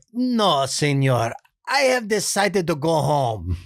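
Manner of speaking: put-on a nice guy begging off in a thick Spanish accent; hint of dubbed Spanish accent; Benito voice. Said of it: "Benito's voice".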